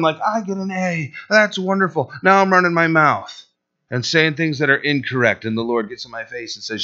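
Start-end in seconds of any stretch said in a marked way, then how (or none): 0.2-2.1 higher, pleased-with-oneself voice; acted swelled-head delight; mock self-congratulation. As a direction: marvel at your own success, playfully. Acts out his swelled head.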